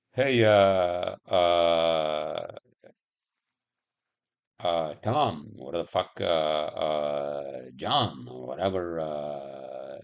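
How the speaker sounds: mock thought message. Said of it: "This is my thought".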